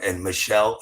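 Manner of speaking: ordinary talk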